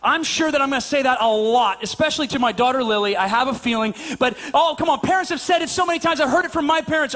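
ordinary talk

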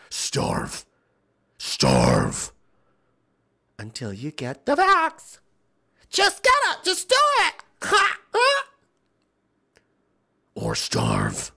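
mock villain ultimatum; menacing enforcer. Acted threat, mocking the coercers.

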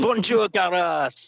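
playful mock French welcome; French vibe. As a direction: lay on a jokey French accent. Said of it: "get in on the French vibe there".

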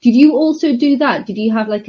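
ordinary talk, nothing marked

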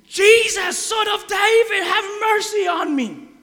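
desperate shout to Jesus, high and loud; crying out. Retold or imitated. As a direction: cry out for mercy from the roadside. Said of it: "he is screaming".

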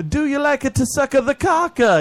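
high, loud put-on broken-English voice; mock broken English. Teasing the Italian guest in a high, loud mock accent. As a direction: ham up the nonsense phrase. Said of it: "then I'd be like".

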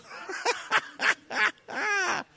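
long, very high cackle; maniacal clown laugh. Acted. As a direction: burst into a mad clown cackle. Clown answers how he feels.

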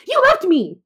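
high furious yell; angry shout. Retold or imitated. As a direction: scream it at them, hurt and accusing. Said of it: "I shouted".

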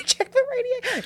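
laughing repeat. Real, not acted. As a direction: echo the joke while cracking up. Repeats partner's joke, laughing.

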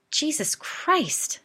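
shocked exclamation. A narrator's reading. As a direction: stretch it out in loud, high-pitched disbelief. Shocked reaction to the lizard story.

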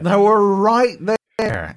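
high, loud, drawn-out "right there"; exasperated insistence. Real, not acted. Repeats it with more force.

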